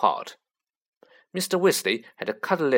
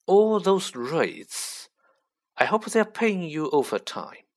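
lightly voiced sneering aristocrat, slow drawn-out greeting; a hint of cold contempt, savouring the name; sneering Lucius Malfoy; 5 semitones higher, slower than usual. A narrator's reading. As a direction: greet your rival with a slow, mild sneer. Lucius taunts Mr Weasley.

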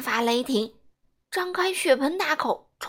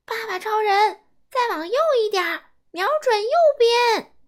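higher, loud, fast calling out directions; loud shout; 7 semitones higher, 7 dB louder than usual. Acted. Boy calling aim directions to Daddy Superman, shouting. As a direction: yell directions to the hero. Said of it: "我大叫".

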